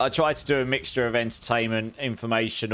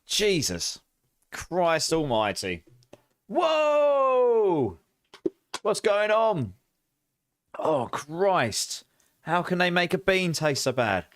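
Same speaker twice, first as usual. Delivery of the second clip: retching, much higher outcry; disgusted; 5 semitones higher, much slower than usual. Real, not acted. Streamer chewing an old bandage bean, revolted. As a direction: gag and shriek at the taste.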